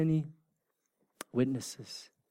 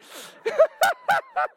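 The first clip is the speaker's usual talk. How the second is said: loud high-pitched laughter; 7 dB louder than usual. Real, not acted. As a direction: crack up, squealing high. Laughing at birthday teasing.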